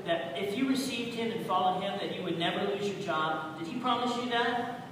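fervent sermon delivery, loud peaks; preaching. Real, not acted. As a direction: preach it to the congregation. Preaching to the congregation.

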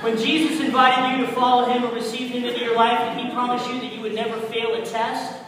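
booming, pressing questions at the crowd; loud preaching. Real, not acted. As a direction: hammer each question home. Preacher building rhetorical questions.

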